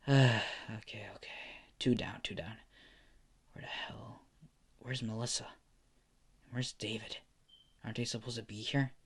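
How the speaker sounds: a wary hero whispering to himself; hushed whisper. Acted. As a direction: keep it under your breath so no one hears. Izuku sneaking after a fight.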